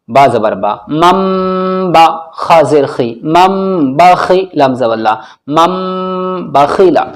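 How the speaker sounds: measured letter drill, long hummed m in "मम"; spelling-out. Shown as a demonstration. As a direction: spell each syllable, then join it on, holding "मम". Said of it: "अब हम इनकी हिज्जे करते हैं". (हिज्जे के साथ, "मम" खींचकर)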